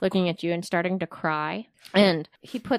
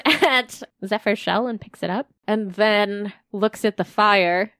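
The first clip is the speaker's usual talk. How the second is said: ordinary talk, nothing marked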